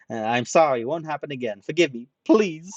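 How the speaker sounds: loud, high, rushed pleading; panicked begging. Acted. Ranta kowtowing to the gangster, panicked pleading. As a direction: grovel for forgiveness.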